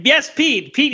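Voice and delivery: high, put-on yes sir voice; playful put-on voice; mock recruit reply. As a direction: answer like a nervous recruit. Mock recruit-style answer.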